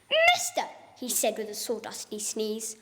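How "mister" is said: high, sharp and bossy; sharpish bossy Lorax. Acted. Lorax snapping at the Once-ler, high and bossy. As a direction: boss him from the stump. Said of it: "a voice that was sharpish and bossy".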